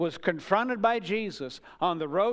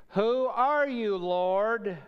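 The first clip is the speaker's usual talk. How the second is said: a raised, higher cry to the heavenly voice; Saul crying out; 5 semitones higher, 5 dB louder, much slower than usual. Acted. Saul on the Damascus road calling up loudly to the voice. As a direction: call up in awe and alarm.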